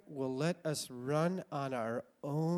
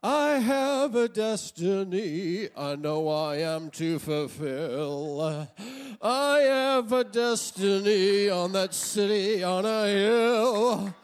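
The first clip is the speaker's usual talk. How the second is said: higher, louder, slow proud declaration; grandiose young dreamer; 7 semitones higher, 6 dB louder, slower than usual. Retold or imitated. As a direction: proclaim your destiny like a cocky teenager. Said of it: "you feel like".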